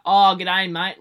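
loud, broad country Australian greeting; real country accent. Acted. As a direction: lay on the country Aussie twang. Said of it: "like, real country".

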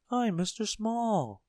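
high, slow small voice; tiny Mister Small. A narrator's reading. Small man answering the postman, high and slow. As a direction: introduce himself high and slow.

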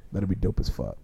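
hushed unvoiced aside; whispering. Real, not acted. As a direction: whisper it like a secret.

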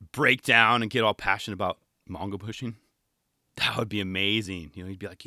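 usual speaking voice

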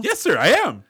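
loud eager soldier answer; proud recruit reply. Pretend. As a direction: bark it back proudly. Recruit answers drill coach.

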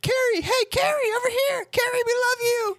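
very high, shrieking fan voices; screaming fans. Acted. Fans in the audience calling out to the star, squealing. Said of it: "a hundred people in the audience that are like".

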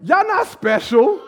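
high, loud teasing shout; God telling Israel they're not special, shouting; mock shout. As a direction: bellow it at them, half joking. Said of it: "So he's like".

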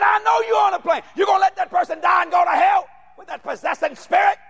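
high, insistent retold scolding; acted-out divine reprimand; exasperated rebuke. God rebukes the reluctant preacher.

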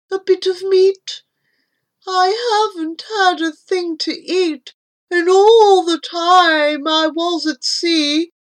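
high, thin-voiced pleading anteater; pitiful starving creature. A narrator's reading. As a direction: beg feebly for food in a high voice. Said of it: "softly said".

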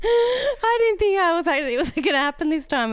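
words squeezed out through high laughter; laughing while talking. Real, not acted. Laughing while telling win.

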